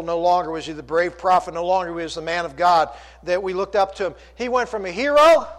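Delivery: ordinary delivery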